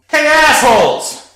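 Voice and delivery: loud, high, drawn-out yell; shouting down stairs. A narrator's reading. Danny yells down the stairs at roommates, loud shout. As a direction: call it down the stairs at full volume. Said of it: "Danny called down the stairs".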